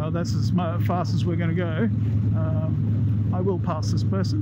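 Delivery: talking inside a motorcycle helmet; muffled helmet mic over engine noise. Real, not acted. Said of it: "in-helmet sound check".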